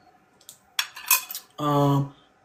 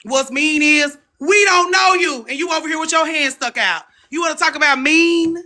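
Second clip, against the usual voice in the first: sassy retort; 10 semitones higher than usual. Retold or imitated. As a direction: clap back with attitude. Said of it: "Y'all was like".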